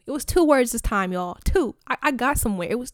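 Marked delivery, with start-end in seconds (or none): 1.4-1.8 higher, louder, punchy two; excited emphasis. Real, not acted. Telling viewers about his reply, excitedly punching two. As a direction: hit it like a triumph. Gloating over two words.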